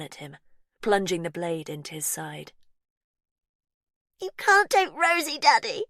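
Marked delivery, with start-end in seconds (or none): 4.2-5.8 much higher, loud shriek; child's cry. A narrator's reading. Faith screaming at daddy while stabbing him. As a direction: scream it in fury. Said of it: "she cried".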